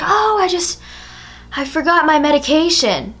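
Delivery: high-pitched, innocent cover story; innocent excuse. Retold or imitated. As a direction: act out a casual fib to Dad. Making an excuse to dad.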